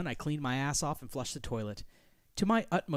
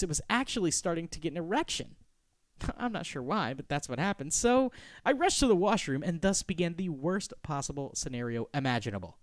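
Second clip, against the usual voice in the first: plain reading voice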